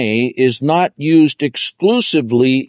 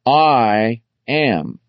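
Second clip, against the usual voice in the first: "I" and "am" each stretched as separate words; deliberate, weighty "I", then "am"; very emphatic. Retold or imitated. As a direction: declare "I am" slowly, a full beat on each word. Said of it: "he very emphatically says".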